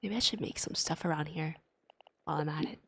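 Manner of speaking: soft unvoiced whisper. Real, not acted. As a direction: whisper to yourself. Muttering to self.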